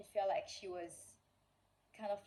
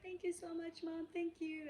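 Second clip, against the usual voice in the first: put-on higher, bright childlike thanks; a hint of a happy child; imitating grateful daughter; 11 semitones higher than usual. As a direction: lightly voice a thrilled little girl. Daughter voiced thanking mom.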